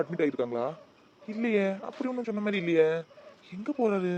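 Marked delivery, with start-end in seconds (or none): none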